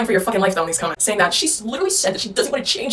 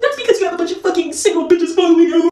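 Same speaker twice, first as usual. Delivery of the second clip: higher, louder mock whine of protest; mocking dudes; 10 semitones higher, 5 dB louder than usual. Answering for dudes who dispute her stats, mocking protest. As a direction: imitate an indignant dude, pitched way up. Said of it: "before dudes are like".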